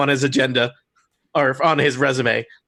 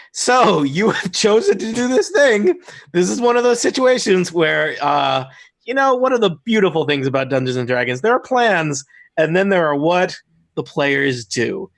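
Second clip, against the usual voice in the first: game master narration; 3 semitones higher than usual. Acted. GM scene setting.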